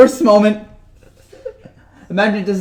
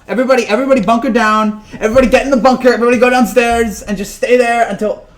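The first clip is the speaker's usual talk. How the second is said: loud, raised mock-urgent call to take cover; mock alarm; 4 semitones higher, 6 dB louder than usual. Vlogger jokingly orders everyone into the bunker, loud mock alarm. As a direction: yell it like a panicked air-raid warden, half joking.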